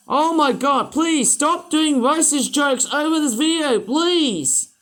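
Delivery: loud, high-pitched exasperated plea; over-the-top exasperated yelling; explosive outburst. Pretend. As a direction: explode and beg them to stop, at full voice. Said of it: "I had to explode my face".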